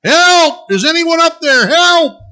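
high, loud cries for help; desperate yelling. Acted. Jack hanging off a cliff yelling for help, desperate shouts. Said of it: "Jack starts yelling".

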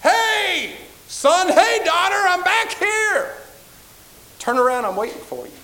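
high, loud, slow call from far behind; Jesus calling out to his children. Acted. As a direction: call out to a lost child from a distance. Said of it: "You hear a voice".

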